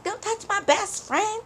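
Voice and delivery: high squeaky imitation of a girl; mock protective friend. Girl defending her best friend, high mock squeal.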